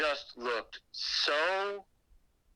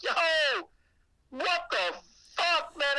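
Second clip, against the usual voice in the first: high, loud incredulous yelp; shocked outburst; 14 semitones higher, 3 dB louder, slower than usual. Retold or imitated. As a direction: blurt it out in total disbelief. His shocked reaction quoted.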